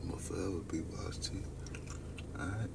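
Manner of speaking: fast, soft whispered vow; hushed, intimate promise to a viewer. Real, not acted. Quiet vow to Julia.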